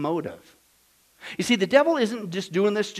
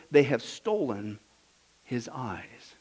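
hushed chilling quote; 8 semitones lower, 6 dB quieter, slower than usual. Acted.

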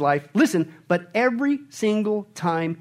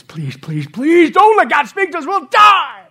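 terrified begging; 10 semitones higher, 11 dB louder, slower than usual. Acted. Israelites begging Moses not to let God speak. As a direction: beg for your life, rising to a shout. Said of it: "they begged Moses".

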